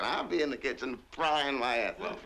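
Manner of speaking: normal talking voice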